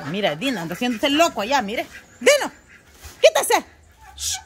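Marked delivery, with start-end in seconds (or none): 2.2-4.4 high, loud order to move; shooing the dog. Real, not acted. Owner ordering her dog Dino away, loud high shout. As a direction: yell at the dog to get away.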